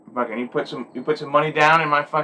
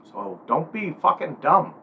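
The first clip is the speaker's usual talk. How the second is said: ordinary delivery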